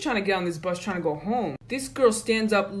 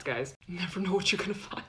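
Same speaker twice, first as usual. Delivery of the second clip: ordinary talk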